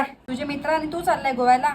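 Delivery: ordinary talk, nothing marked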